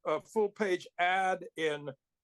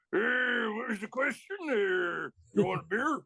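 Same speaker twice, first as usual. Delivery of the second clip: Pitbull; 4 semitones higher than usual. Retold or imitated. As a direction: imitate an evasive biker, stretching the words. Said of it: "that was Pitbull's favorite saying".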